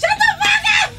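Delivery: high, loud yelling; shout. Real, not acted. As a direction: yell it in disbelief. Friend reacts to the joke.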